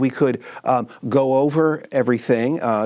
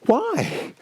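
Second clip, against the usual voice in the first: shocked; 12 semitones higher than usual. Acted. Shocked at cancellation.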